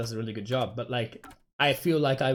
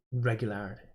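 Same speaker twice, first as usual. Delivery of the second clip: ordinary talk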